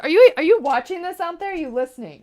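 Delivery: loud, high surprised question; calling out. Real, not acted. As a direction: call through to the next room. Calls to helper off in another room.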